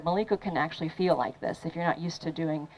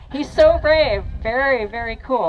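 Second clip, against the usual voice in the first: much higher, louder praise, He's so brave; excited praise; 4 semitones higher, 7 dB louder than usual. Real, not acted. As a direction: cheer the student on. Praising Ronnie on the board.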